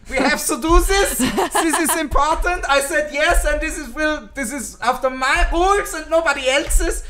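mock German accent. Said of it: "my English American German accent".

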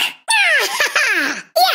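high, gloating evil cackle; villain cackle. Acted. Villain warning a foe about their luck, high cackle. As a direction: cackle in wicked triumph.

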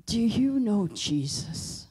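put-on slow, rasping child's question; raspy little girl. Daughter with a damaged throat asking the nurse, slow raspy voice. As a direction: croak it out slowly like a hurt throat.

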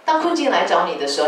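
usual speaking voice

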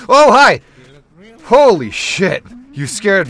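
loud high jump-scare shriek; shriek, then calm down; startled scream. Real, not acted. Startled by a sudden encounter.

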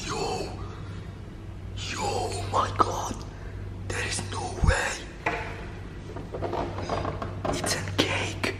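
very soft, voiceless whisper; hushed whisper. Acted. Vlogger sneaking to the door for viewers, nervous whisper. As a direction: whisper as if afraid of being heard.

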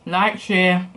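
talking while chewing food; muffled through a mouthful; mouth full, chewing. Real, not acted. Seen 2: big forkful at -4, lips closed and working through the words.

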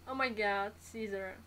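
ordinary talk, nothing marked

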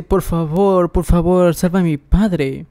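ruego lloroso fingido, en tono más agudo; súplica llorosa. A narrator's reading. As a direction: suplica como al borde del llanto, sin llorar de verdad. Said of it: "llorando dice". (narrar un ruego con leve llanto)

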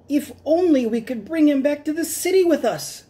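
high squeaky scientist voice; a greedy little mouse doctor dreaming aloud. Acted. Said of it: "said Doctor Varmint".

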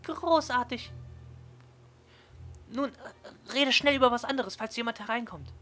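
put-on high, eager girl voice; bubbly and thrilled; excited. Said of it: "klatscht aufgeregt in die Hände".

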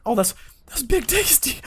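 high-pitched burst of real surprise; stunned excitement. Real, not acted. Stunned by unexpected encounter with friend.

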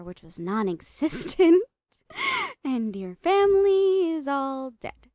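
gleeful gloating. Acted. Gloating over victim's lonely life.

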